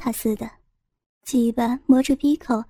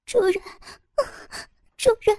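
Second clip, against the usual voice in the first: much higher, tearful female cry; crying woman voice; 8 semitones higher, 4 dB quieter, faster than usual. Acted. Woman cries out to him.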